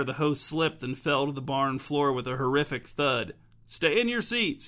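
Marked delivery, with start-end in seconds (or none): 3.6-4.7 put-on high, feeble old voice; frail old man calling out; weak old man. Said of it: "he cried in a weak voice".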